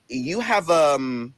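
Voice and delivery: halting, drawn-out guesswork; stretched words while stalling; acted hesitation. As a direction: hand out plates unsurely, with pauses. Unsure whose plate is whose.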